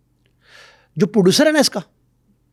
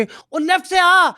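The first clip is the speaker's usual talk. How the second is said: mock gamer shout; 14 semitones higher than usual. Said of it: "اور وہ کہہ رہے تھے".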